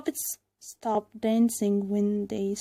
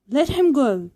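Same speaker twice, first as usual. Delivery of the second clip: loud, higher urgent shout; cried out; 5 semitones higher, 9 dB louder than usual. A narrator's reading. Pinocchio shouting at the puppet master, loud. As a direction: yell to save the old puppet. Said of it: "he cried".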